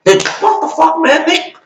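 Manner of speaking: can't believe the double standard; exasperated what the fuck. Real, not acted.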